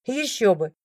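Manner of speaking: ordinary delivery, nothing marked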